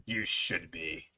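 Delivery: mock menace. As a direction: draw it out like a teasing warning. Teasing threat to fearful listener.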